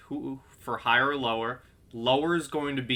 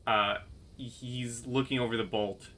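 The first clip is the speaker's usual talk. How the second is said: ordinary talk, nothing marked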